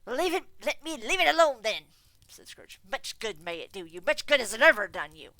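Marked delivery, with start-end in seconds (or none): 0.1-1.9 loud, high, exasperated; dismissive miser. Acted. Scrooge dismissive. 2.9-5.3 loud, contemptuous jab; sneering. Acted. Scrooge sneering.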